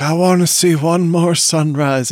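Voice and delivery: a slightly hammed-up, wistful dying wish; mock it as corny melodrama, lightly; a touch of sarcastic sentimentality; mock hokey line. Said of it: "a little hokey how he's like".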